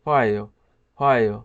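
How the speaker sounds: ফায়ো as one contracted word, vowels fused; shortened pronunciation. Shown as a demonstration. Teacher demonstrating the Korean contraction, ফায়ো in shortened pronunciation. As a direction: say ফায়ো compactly, gliding straight into the ending. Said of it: "এটা সংক্ষিপ্ত উচ্চারণ করে".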